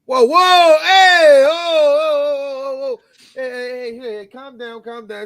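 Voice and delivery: high, loud whoas fading into hushed calm down; hammed-up boss breaking up a fight, settling down; mock frantic calming. Acted bar owner breaking up fight.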